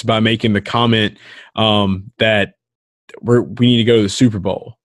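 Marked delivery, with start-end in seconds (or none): none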